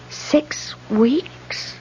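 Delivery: breathless, voiceless question; stunned whisper. Acted. On the phone to the paper, a shocked whisper. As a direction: let the shock drain the voice away.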